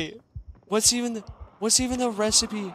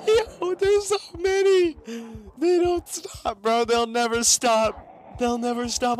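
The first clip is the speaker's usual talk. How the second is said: high, slow frantic panic; panicking gamer; 5 semitones higher than usual. Real, not acted. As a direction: panic as the horde closes in. Zombie horde chasing.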